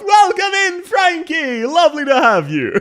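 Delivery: very high, loud greeting; called-out welcome. Real, not acted. Streamer welcoming a viewer by name, high loud call-out. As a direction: sing out a high, excited hello.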